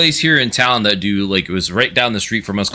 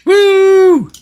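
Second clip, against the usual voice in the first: high cheering woo; excited whoop; 8 dB louder than usual. Real, not acted. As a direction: let out a loud high whoop. Cheering a toy.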